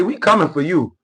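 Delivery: ordinary delivery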